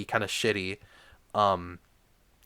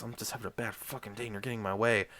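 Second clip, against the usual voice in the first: softer, fast, low grumbled mock Gargamel; gruff Gargamel mutter; 3 semitones lower, 9 dB quieter, faster than usual. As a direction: mutter it quickly like a fed-up grump. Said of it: "And he goes".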